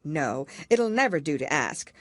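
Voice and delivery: ordinary delivery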